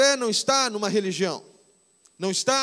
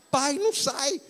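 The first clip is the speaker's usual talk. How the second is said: put-on child complaining; 5 semitones higher than usual.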